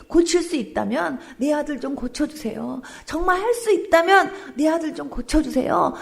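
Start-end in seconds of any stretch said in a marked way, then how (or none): 3.1-5.9 a louder, higher repeat of the plea, 할 pressed hard. Retold or imitated. Pastor retelling father begging Jesus, louder and higher. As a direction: push the plea up, begging harder. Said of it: "막 애원을 한 거예요".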